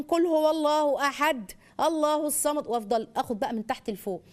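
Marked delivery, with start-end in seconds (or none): 0.0-2.7 put-on slow, clear recitation for a child; slow teaching recitation. Shown as a demonstration. Mother teaching a short verse, slow and clear. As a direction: recite each word slowly for the child. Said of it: "وبعدين".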